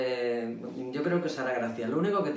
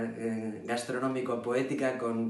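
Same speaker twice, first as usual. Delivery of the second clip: normal talking voice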